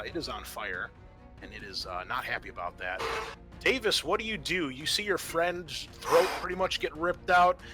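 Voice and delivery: normal talking voice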